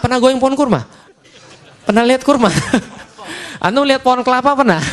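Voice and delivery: high, laughing through the questions. Real, not acted. Preacher laughing as he quizzes the mothers about date palms. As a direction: tease the audience while chuckling.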